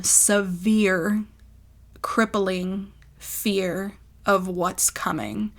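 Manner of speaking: word by word, slowly, with pauses; very slow, deliberate phrasing; slow emphatic. Real, not acted. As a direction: let each heavy word land. Dwelling on her fear for emphasis.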